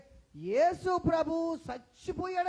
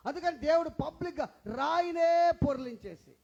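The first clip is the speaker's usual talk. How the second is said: louder, leaning on రాయినే; preached emphasis; 3 semitones higher, 7 dB louder than usual. Acted. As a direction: punch రాయినే for the crowd. Preacher stresses stone rolled away.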